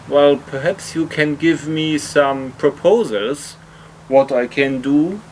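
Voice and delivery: ordinary talk, nothing marked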